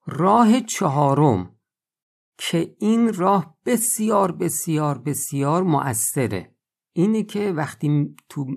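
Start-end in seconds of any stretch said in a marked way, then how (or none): 2.4-6.6 first بسیار lifted high, the repeats hammered more gently; repeated بسیار building emphasis. Acted. Preacher urging the fourth remedy on listeners, insistent بسیار. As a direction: stack بسیار three times, punching the first hardest.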